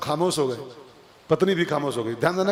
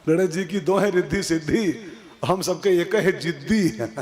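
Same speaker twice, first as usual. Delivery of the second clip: laughing punchline; 3 semitones higher than usual. Real, not acted. Preacher jokes to the audience about wives, laughing. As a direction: laugh as you land the wife joke.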